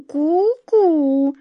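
high, drawn-out cuckoo call; thoughtful cuckoo. Acted. Cuckoo answering the wizard's guess, thoughtfully. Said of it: "немного подумав, откликнулась кукушка".

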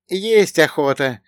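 high, slow, plaintive companion; sighed. A narrator's reading. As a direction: draw out the hunger with a sigh. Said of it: "произнёс со вздохом Богдан".

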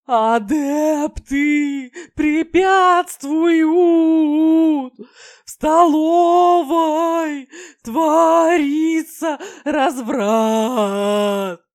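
high, loud, slow wailing howl. Acted. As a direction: wail it out in a shifting voice. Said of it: "выл на разные голоса".

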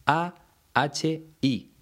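each letter of "A H I" named clearly and apart; deliberate spelling of "A H I"; spelled letter by letter. Shown as a demonstration. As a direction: spell out "A H I" one letter at a time. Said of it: "se escribe, como te decía".

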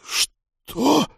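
high, shocked question; stunned. A narrator's reading. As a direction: gasp it out in disbelief. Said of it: "опешил начальник стражи".

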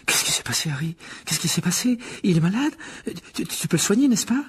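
put-on high, breathy, stammering young fan; agitated young boy. A narrator's reading. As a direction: pelt out anxious questions in a high child's voice. Voices excited boy Colin. (garçon surexcité, voix aiguë et haletante)

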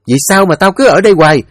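high, loud sister screaming; furious shout. Acted. Sister screaming at her unfilial brother, furious. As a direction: yell at the brother in fury. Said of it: "Nhiếm cũng tức quá".

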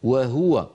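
وهو said wa-huwa, ha clearly with u; ha with damma. Shown as a demonstration. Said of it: "هكذا".

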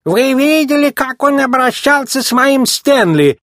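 very high, put-on old lady voice; indignant old woman. A narrator's reading. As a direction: complain like the town's scandalized oldest resident. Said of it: "возмутилась Лизи, самая старая жительница города".

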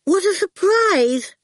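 high, slow, strained pleasantness; forced pleasantness. A narrator's reading. Miss Potter greeting unwelcome callers, forced pleasantness. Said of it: "forced a smile".

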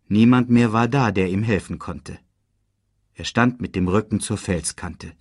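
ordinary delivery

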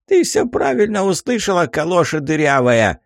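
put-on high insulting old man; cranky old geezer. Old man insults crone back.

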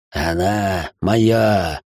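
slow, low bubbling monster voice; gurgling tentacle alien. Acted. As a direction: gurgle it out through blood. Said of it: "продолжил булькать незваный гость".

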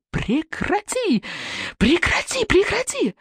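high, slow shrieks, rising each time; frantic screaming. A narrator's reading. Mother loses control and shrieks at her kicking son. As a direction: shriek it like a woman losing control. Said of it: "Она визжала, как полоумная".

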